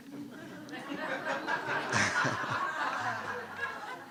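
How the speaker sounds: a high, delighted squeal of laughter. Real, not acted. Reacting to the fireman's advice with a high squealing laugh.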